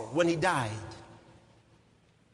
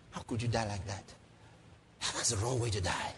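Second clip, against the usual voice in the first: low, hushed disappointment; subdued, pondering delivery; quiet reproach; 6 semitones lower, 6 dB quieter than usual. Acted. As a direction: rebuke the dead man softly. Quiet reproach to dead Abner.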